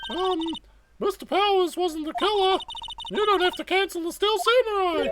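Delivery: put-on high-pitched, earnest girl sidekick; eager young sidekick. Voices Maya pleading for show.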